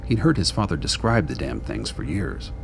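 ordinary talk, nothing marked

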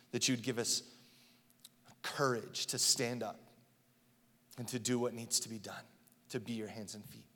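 hushed, low prayer voice. Acted. Pastor praying quietly over the church. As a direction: pray softly before the congregation. Closing prayer before sermon.

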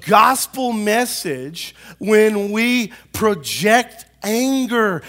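high, loud, slow preacher cadence; full-force preaching. Real, not acted. Pastor warning church family about their witness, preaching. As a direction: stretch and pound each key word.

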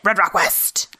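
hammed-up movie title, punched out; mock-dramatic trailer flourish; showy title delivery. Shown as a demonstration. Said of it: "you have to say it".

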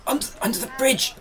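high and quick, urging the group; urgent call. Acted. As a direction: hurry everyone to cover. Calling the group to hide.

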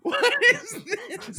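slow, high, laughing what is this; laughing disbelief. Real, not acted. Host laughing in disbelief at a clip, slow and high. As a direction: stretch it out through a laugh.

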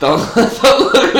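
breaking into laughter, high; giggling through the words; laughing. Real, not acted. Cracking up mid-sentence.

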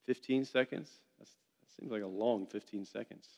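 quiet, pinched words through a held nose; holding nose. Real, not acted. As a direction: talk while pinching your nose shut. Leader holding his own nose.

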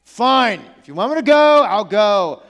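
put-on drawn-out, exasperated "fine" of a reluctant kid; begrudging. Jonah giving in to God like kids told to clean, begrudging. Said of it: "he's, like, dragging his feet".

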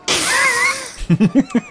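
sudden high squeal of a laugh; high burst of laughter. Real, not acted. Player bursts out laughing at the character's urgency, high squeal of a laugh.

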